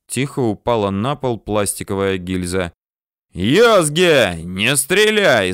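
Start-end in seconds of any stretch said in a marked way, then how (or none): 3.3-5.5 loud, drawn-out urgent shout; slow, loud warning yell. A narrator's reading. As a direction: bellow a plea to stop, stretching the words. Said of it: "заорал он".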